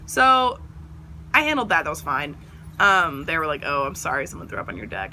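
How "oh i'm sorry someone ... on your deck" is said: tag. Retold or imitated. Own deadpan retort to neighbors.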